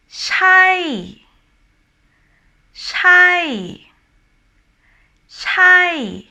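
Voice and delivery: "shy" high, then sliding down; falling tone. Shown as a demonstration. Teacher giving example words, falling tone "shy". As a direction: push "shy" up high and drop it. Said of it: "talking about a falling tone".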